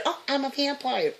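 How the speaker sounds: high, sneering mimic of a critic; mock them in a silly voice; sarcastic high put-on voice; mocking quote. Mocking what critics claim.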